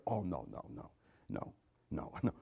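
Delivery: low, very soft string of noes; dismissive murmur. Acted. Imagined believer answering about God walking the earth, quietly dismissive. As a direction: brush it off quietly with an unvoiced chuckle.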